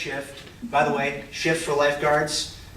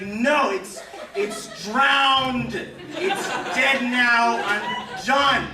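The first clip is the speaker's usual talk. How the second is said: high, laughing mock grief over the drowned brick; laughing despair; 7 semitones higher, much slower than usual. Telling the lady the brick drowned, laughing mock grief. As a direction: laugh it out like the brick truly died.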